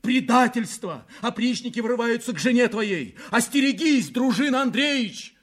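high, urgent alarm; shouted warning from below. A narrator's reading. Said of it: "раздался голос снизу".

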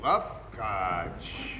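a low, soft murmur of grudging awe; whispery admiration; quiet musing. Acted. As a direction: turn the word over to yourself. Husband muses, then exclaims.

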